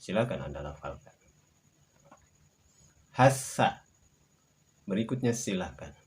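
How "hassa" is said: Hassa with a long held ss; shadda demo. Shown as a demonstration. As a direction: model Hassa clearly. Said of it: "samakan dengan contoh pelafalan kami".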